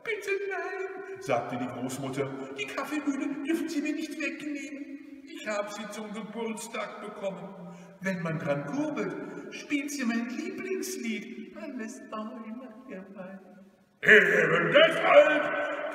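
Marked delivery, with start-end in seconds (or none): 0.0-1.2 put-on very high, frightened old lady; anxious high-pitched plea; pleading grandmother. 2.6-13.4 put-on high-pitched old-lady voice; high, helpless entreaty; pleading grandmother. Grandmother pleads for her birthday present. 14.0-15.9 loud, drawn-out growl; slow, forceful snarl. Acted. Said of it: "knurrte der Räuber Hotzenplotz".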